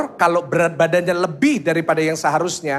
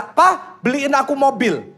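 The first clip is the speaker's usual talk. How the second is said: put-on higher, slow begging kid voice; whiny child; 8 semitones higher, slower than usual. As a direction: beg like an eleven-year-old.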